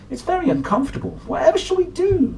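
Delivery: very high, fast fretting cat voice; fretful Flabby Cat. Acted. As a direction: fuss in a high, hurried cat voice. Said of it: "said Flabby Cat".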